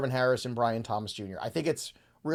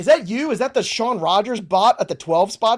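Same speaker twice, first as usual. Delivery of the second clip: excited teasing; 6 semitones higher, 8 dB louder than usual. Real, not acted. Teasing the bot's pick.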